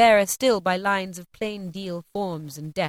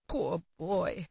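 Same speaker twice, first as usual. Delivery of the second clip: pitying; 3 semitones lower, 8 dB quieter than usual. A narrator's reading. Scrooge pities his younger self.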